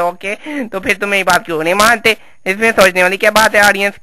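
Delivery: ordinary talk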